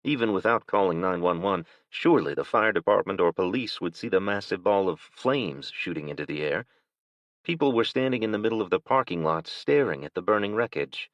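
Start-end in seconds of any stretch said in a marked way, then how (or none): none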